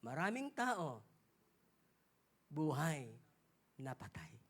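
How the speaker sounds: dropping to a low hush for the punchline; hushed and grave. Acted. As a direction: let it sink in, almost swallowed. Sermon climax, hushed repeat.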